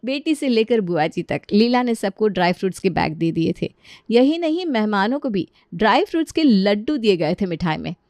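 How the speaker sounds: ordinary delivery, nothing marked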